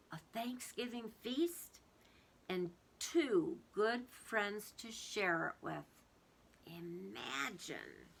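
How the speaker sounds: tender. Acted. Missus Gum's tender thankful ending.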